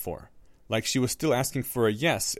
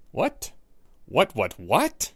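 startled; 3 semitones higher, much slower than usual. A narrator's reading. Harry startled.

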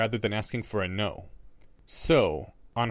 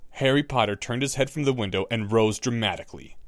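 plain reading voice

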